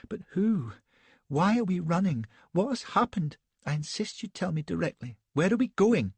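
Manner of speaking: put-on high-pitched, petulant, breathless young lady; a shaken, indignant society wife; Elsbeth, fluttery young wife. A narrator's reading.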